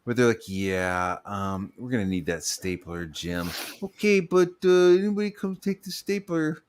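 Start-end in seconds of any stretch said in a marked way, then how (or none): 0.5-3.5 low, soft, drawn-out boss voice; office boss. Acted. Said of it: "like that guy from fucking The Office". 3.8-6.6 high-pitched meek employee impression; put-upon office worker. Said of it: "like that guy from fucking The Office".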